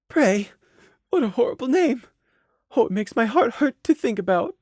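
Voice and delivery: pained thought. Acted. Mare's pained private thought.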